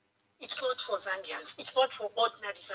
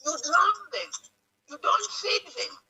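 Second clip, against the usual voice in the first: higher and louder, voice rising with real upset; emotional outburst; 8 semitones higher, 5 dB louder than usual. Real, not acted. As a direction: repeat it, pushing the words up in indignation. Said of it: "I'm so emotional right now".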